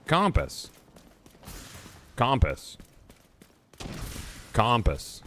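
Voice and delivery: "Compass" with an open ah in the first syllable, KAHM-puhs. Shown as a demonstration. Streamer showing chat how they say it, "Compass" as KAHM-puhs. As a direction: say "Compass" as a demo, first vowel like "calm". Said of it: "That is an O sound".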